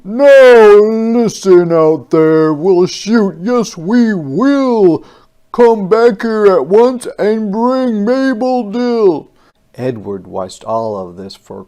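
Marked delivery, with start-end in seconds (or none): 0.0-9.3 roaring generals. Acted. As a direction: roar it red in the face through a loudspeaker. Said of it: "generals roared till red in the face".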